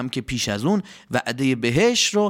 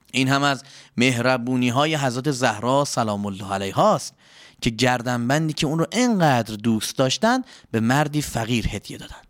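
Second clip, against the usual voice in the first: ordinary delivery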